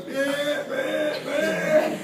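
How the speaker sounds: high, blaring honks; spaced car-horn blares made with the voice; imitated car horn. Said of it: "blaring on a horn, just like".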